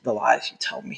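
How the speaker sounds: soft breathy whisper of disbelief; whispered aside. Real, not acted. Husband whispers disbelief at wife's claim about heat. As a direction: whisper it under your breath.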